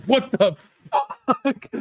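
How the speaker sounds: high, stretched-out stunned cry through laughter; shocked. Retold or imitated. Drunk kid spotting Kevin Nash, stunned and laughing. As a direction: gasp it out, floored and laughing. Guy sees seven foot Nash.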